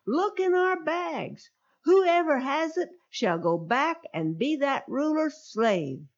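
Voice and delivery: high, sure-of-themselves brothers; confident protest. Acted. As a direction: dare them to search, certain of innocence.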